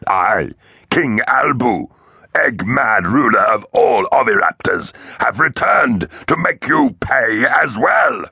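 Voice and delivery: slow, high, grandiose villain proclamation; fierce gurgling king. A narrator's reading. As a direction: proclaim your return, stretching every word. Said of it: "fierce and gurgling".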